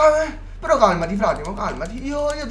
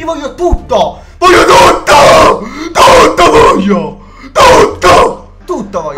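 slow, much louder, strained mock cry; hammed-up greedy yell; 9 dB louder, slower than usual. Demanding the whole inventory from his friend, mock wailing yell. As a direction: bellow it like a spoiled child.

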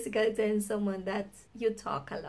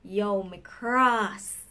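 slow cross; 2 semitones lower, much slower than usual. Retold or imitated. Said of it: "they start saying".

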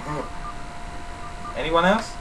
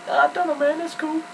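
high put-on laid-back voice; dopey, high drawling buddy; imitating friend; 4 dB quieter than usual. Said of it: "And he's like".

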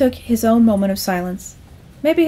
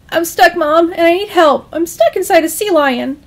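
high, loud, distressed son; raised-pitch panicky explaining; urgent plea; 7 semitones higher, 4 dB louder than usual. Acted. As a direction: plead with mom for rescue. Son pleading with mother.

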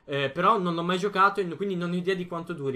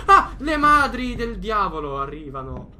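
panicked scream then louder cry; scared yell while fleeing; being chased; 4 semitones higher, 10 dB louder than usual. Real, not acted. Chased in horror game.

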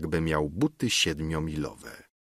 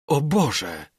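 put-on higher, alarmed gasp of a frightened old councillor; frightened cry; 7 semitones higher than usual. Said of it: "zawołał radca wystraszony".

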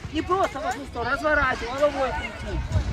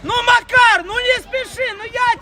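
yelling from the sidelines; 5 semitones higher, 6 dB louder than usual. Real, not acted. Coach calling to player.

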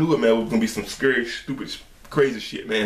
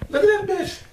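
high squeal of disgust; disgusted shriek; slower than usual. Real, not acted. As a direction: recoil with a high yeesh. Watching snake move.

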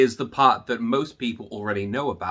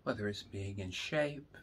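guided meditation; 10 dB quieter, slower than usual. Acted. Teacher leading students through breathing, slow and soft. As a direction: guide them into calm, slowly.

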